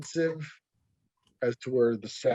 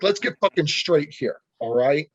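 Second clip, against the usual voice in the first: ordinary delivery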